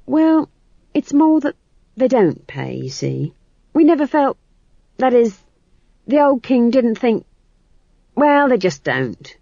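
high, halting, anxious excuses; flustered chamberlain. Acted. Chamberlain explains untaxed witches to Duke, flustered and halting. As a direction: break off and restart nervously.

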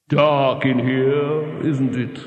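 slow, close eerie voice in the dark. Acted. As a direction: murmur it right by his ear. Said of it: "a voice has just said".

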